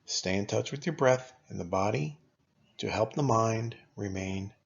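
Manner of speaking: ordinary talk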